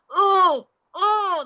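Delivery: high, loud "oh" of pain; imitated pain groans.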